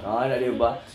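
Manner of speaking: ordinary talk, nothing marked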